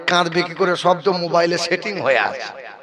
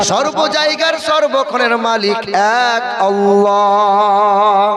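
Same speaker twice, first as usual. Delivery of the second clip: raised, louder sermon chant, slowing on the holy names; full-force preaching; 3 semitones higher, 3 dB louder, slower than usual. Real, not acted. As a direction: preach it out over the crowd, drawing out each line. Preacher rises into full waz.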